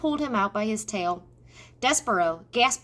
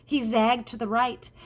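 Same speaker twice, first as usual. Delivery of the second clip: usual speaking voice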